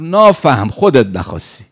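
retold Mashhadi-accented outburst, punched out loud; thick Mashhadi accent. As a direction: imitate a blunt scolding in broad Mashhadi. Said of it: "با همون لهجهٔ غلیظ مشهدی و خراسانی".

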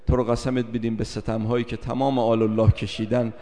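usual speaking voice

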